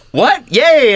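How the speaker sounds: high, loud mock cheer; excited. Host retelling news of getting into the program, excited. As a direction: reenact a burst of delighted surprise.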